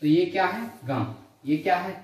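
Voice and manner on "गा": "गा" as a velar nasal, ṅã; nasalised ṅa. Shown as a demonstration. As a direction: let the air of "गा" out through mouth and nose. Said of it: "प्राणवायु मुख और नासिका दोनों से निकलती है".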